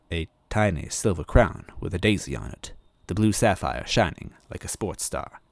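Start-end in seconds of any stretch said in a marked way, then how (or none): none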